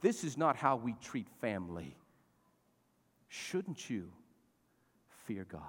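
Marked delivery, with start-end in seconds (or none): 3.3-5.6 whisper. Acted. Quoting Nehemiah, hushed.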